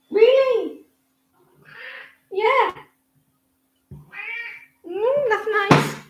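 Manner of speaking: pet-talk coo. Real, not acted. Owner chatting to her pet in a high coo.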